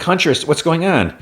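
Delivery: high, bewildered question; high puzzled call; confused call. Retold or imitated. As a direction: ask in bewilderment. Fiona confused.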